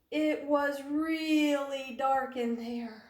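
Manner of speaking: slow, eerie storytelling; spooky storytelling. A narrator's reading. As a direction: linger on the dark.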